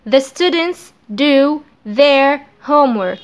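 The students do their homework read slowly; slow dictation. Shown as a demonstration. (نطق بطيء)

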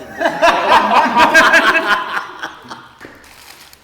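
big loud laugh; loud burst of laughter. Real, not acted. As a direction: crack up loudly. Friends laughing at sugar.

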